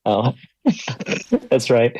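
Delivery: normal talking voice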